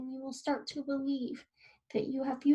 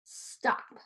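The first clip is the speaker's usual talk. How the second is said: firm stop. Shown as a demonstration. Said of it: "imagine a stop sign".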